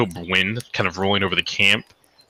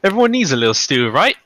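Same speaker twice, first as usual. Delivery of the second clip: higher-pitched, cheery character voice; upbeat camp cook, pitched up; wide-smiling cook; 8 semitones higher, 3 dB louder than usual. Acted. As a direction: say it through a big smile, lifting at the end. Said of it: "gives a wide smile and says".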